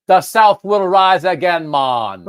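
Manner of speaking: slow, loud mock Southern drawl; bad Southern accent. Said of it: "he says, Southern guy".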